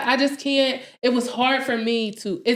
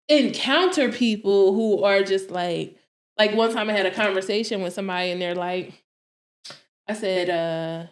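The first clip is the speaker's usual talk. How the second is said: ordinary delivery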